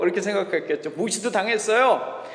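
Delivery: ordinary talk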